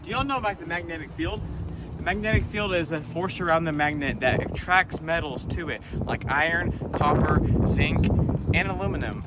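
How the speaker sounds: high, eager explainer voice; bright curious science host. Acted.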